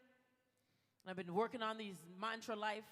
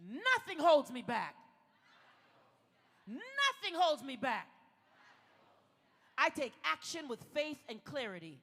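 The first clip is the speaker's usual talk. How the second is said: big-voice affirmations; 7 dB louder than usual. Shown as a demonstration. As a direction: proclaim each affirmation loudly for a call-and-response. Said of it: "Out loud, big voice".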